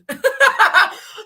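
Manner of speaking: high, loud burst of laughter. Real, not acted. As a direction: let out a big, high laugh.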